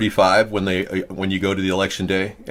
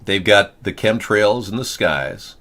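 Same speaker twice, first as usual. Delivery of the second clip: ordinary talk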